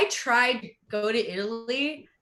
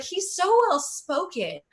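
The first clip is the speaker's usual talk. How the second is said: lightly raised, mock-gushing mom; a hint of an impressed mother praising a rapper; imitating mom; 6 semitones higher than usual. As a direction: gush like a proud mom, only a touch higher. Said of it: "she's like".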